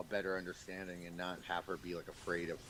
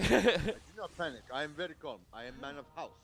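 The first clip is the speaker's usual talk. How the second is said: put-on high African accent, proud; 6 semitones higher, 8 dB louder, faster than usual. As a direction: puff up as man of the house in an African accent. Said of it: "this African dude".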